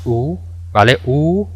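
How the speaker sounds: U held long and drawn out. Shown as a demonstration. Teacher demonstrating the long vowel to class, lengthened. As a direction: stretch the U out. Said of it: "alargarlo un poco más".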